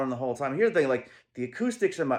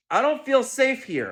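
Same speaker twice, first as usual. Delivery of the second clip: higher, louder whiny mockery of her excuse; mock whine; 12 semitones higher, 7 dB louder than usual. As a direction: parrot her defense in an exaggerated whine. Mocking the avoidant's defense.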